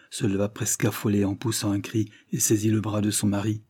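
plain reading voice

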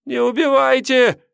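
very high, thin, loud plea; plaintive begging. A narrator's reading. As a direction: beg pitifully in a squeaky, trembling voice. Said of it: "жалобно попросил". (жалобная мольба тонким голосом)

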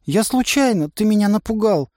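put-on high girl's defensive voice; half excuse, half blame. A narrator's reading. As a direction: excuse yourself and accuse. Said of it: "То ли оправдалась, то ли обвинила". (высоким голосом оправдывается)

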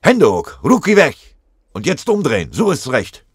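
very loud and high, hands up!; shouted commands. Acted. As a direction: bark every order at full voice. Soldier captures sniper, shouting.

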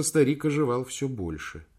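ordinary delivery

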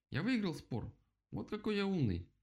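high-pitched boasting; triumphant exclamation. A narrator's reading. Rabbit boasts of winning the argument, exclaiming. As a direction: crow with pride, pitch well up. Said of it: "воскликнул кролик".

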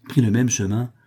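usual speaking voice

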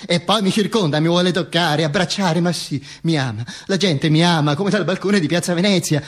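ordinary delivery, nothing marked

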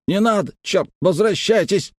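high, strained, urgent order; very tense. A narrator's reading. Engineer ordering the jeep back over the radio, very tense. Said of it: "Арнольд разговаривал очень напряжённо".

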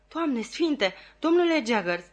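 high-pitched, distraught appeal; hysterical outcry. A narrator's reading. Client crying out to the lawyer, hysterical. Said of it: "răgni individul isterizat".

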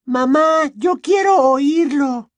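high, loud, drawn-out whine; whimpering. A narrator's reading. Girl begging her mother to let her listen, whimpering. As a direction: whine pleadingly to your mother. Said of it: "gimoteó Ginny".

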